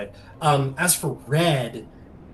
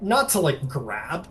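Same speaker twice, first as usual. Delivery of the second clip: usual speaking voice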